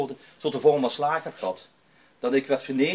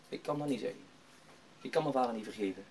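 much softer, subdued voice; retold reluctant admission; 5 semitones lower, 11 dB quieter, faster than usual. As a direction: act out a painful refusal under the breath. Hurt young man refuses to pray.

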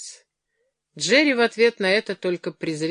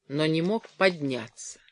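ordinary delivery

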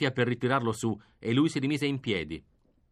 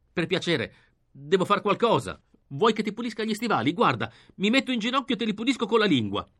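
high, fast grovelling plea; higher and faster desperate begging; frantic begging; 7 semitones higher than usual. Acted. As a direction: grovel quickly, voice climbing. Begging to lick his boots.

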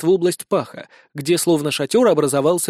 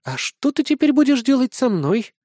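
put-on higher-pitched, uneasy girl; mildly frightened, raised pitch; nervous question; 7 semitones higher than usual. A narrator's reading. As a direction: ask warily after a hard gulp. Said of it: "громко сглотнула и спросила".

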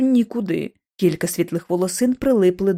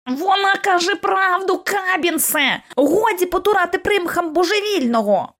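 Kipps shouting; 9 semitones higher than usual. A narrator's reading. Kipps voiced.

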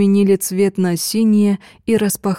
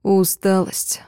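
plain reading voice

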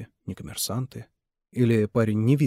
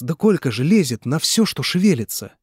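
indignant outburst; 4 semitones higher, 6 dB louder than usual. Acted. Mitya accuses Nikolai to father.

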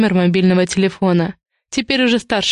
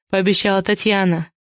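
usual speaking voice

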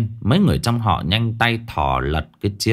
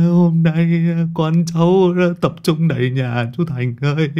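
mock wailing; 7 semitones higher than usual.

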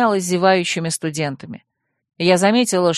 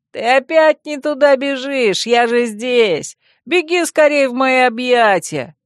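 higher, slow playful shout; shouting after; 5 semitones higher, 4 dB louder, slower than usual. Acted. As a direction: call after her teasingly. Vemut shouts after running girls. (игривый крик вдогонку)

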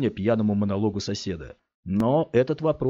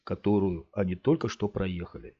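ordinary delivery

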